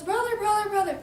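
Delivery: high, loud, repeated call; urgent storybook plea. Acted. Pig runs to brother's house, high urgent calling. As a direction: cry out for help, play-acted.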